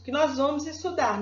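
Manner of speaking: ordinary talk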